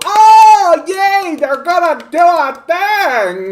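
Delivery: very high, loud squeaky cheering voice, slow; mock cheer. Streamer mocks Canadian people cheering a tiny policy, squeaky high cheer. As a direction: shriek with dumb delight.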